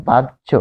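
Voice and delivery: বাহ্য as "baj-jho", in two parts; careful pronunciation. Shown as a demonstration. Teacher gives learners an easier example, careful pronunciation. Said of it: "আরো সহজ করে যদি বলি".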